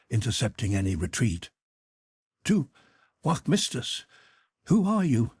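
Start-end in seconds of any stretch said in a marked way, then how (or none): none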